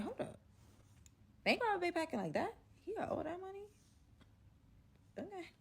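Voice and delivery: high, hushed reconsidering; a hushed, high "hold up"; intrigued surprise. Retold or imitated. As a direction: perk up at the money news. Learns Bay has money.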